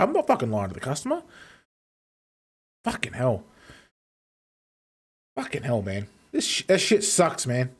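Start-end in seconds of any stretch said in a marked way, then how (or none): none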